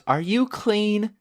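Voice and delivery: a cashier eyeing muddy players, slow and wary; imitating a wary cashier. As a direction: ask it like she dreads the answer. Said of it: "she just looks at us and goes".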